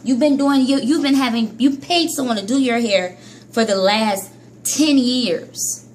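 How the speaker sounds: ordinary delivery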